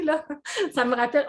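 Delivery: ordinary talk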